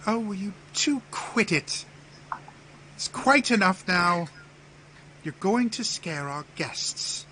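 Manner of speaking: high-pitched, put-on regal woman's voice; scolding Empress. Empress tells the squabbling two to quit, scolding.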